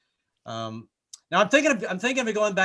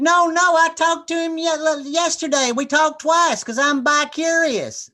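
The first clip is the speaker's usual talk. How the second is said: much higher, louder, slow chirpy mother voice; mock sweet Southern mom; 14 semitones higher, 9 dB louder, slower than usual. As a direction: chirp it out innocently.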